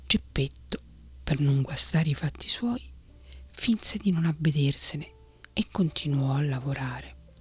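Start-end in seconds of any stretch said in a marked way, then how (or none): none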